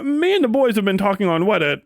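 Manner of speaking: ordinary talk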